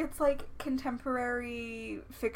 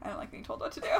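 whispering; 7 dB quieter, much faster than usual. Pretend. Mock defiance, whispered.

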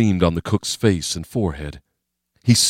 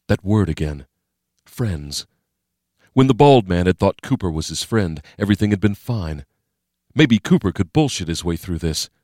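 usual speaking voice

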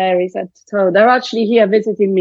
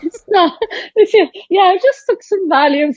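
laughing; 10 semitones higher than usual. Real, not acted. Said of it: "laughing joke".

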